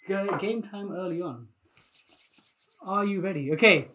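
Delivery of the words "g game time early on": softer repeat of an announcement; quiet echo. Real, not acted. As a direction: drop it right down in volume.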